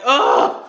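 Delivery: loud "ugh" of exasperation; disgusted groan. Real, not acted. Reacting to the interviewers' insulting questions, exasperated groan. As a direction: groan it out, fed up. Fed up after quoting interviewers.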